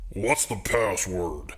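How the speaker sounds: slow, lightly voiced gruff doorman; a hint of a tough bouncer through the peephole. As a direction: doorman asks the password. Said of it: "a gruff voice called out".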